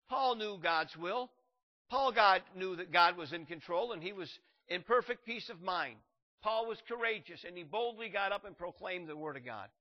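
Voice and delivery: loud, fervent preaching. Real, not acted. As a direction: preach it with full force. Preaching at full force.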